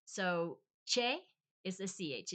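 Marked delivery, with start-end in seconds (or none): 0.8-1.2 che as CH plus long A; Spanish che. Shown as a demonstration. Teaching the Spanish CH, che said as a loud CHAY. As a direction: punch che out as 'chay'. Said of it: "It's just like a cha".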